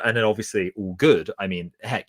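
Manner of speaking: ordinary talk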